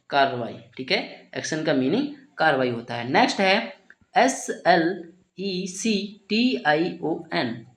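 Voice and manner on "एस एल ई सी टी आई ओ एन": slow letter-by-letter spelling of डीईसीआईएसआईओएन. Shown as a demonstration. Teacher spelling the word for students, slow and letter by letter. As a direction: spell each letter out slowly, one by one. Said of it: "इसे क्या पढ़ेंगे".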